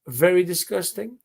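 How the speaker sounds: ordinary talk, nothing marked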